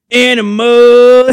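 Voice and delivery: high, loud, drawn-out singer's yell; rock singer yell. Retold or imitated. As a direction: belt it like a [name] frontman. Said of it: "the lead singer just goes".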